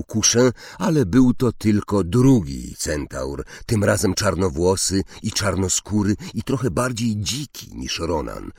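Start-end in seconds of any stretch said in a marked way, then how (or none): none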